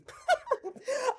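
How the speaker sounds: very high thin giggle; real high-pitched laugh; high squealing laugh. Real, not acted. Laughs at her own joke.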